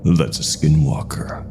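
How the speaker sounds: plain reading voice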